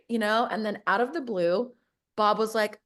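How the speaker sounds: usual speaking voice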